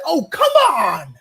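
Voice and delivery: high, loud mock exasperation as Jim Carrey. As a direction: toss it up like a fed-up Jim Carrey. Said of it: "doing the Jim Carrey gif".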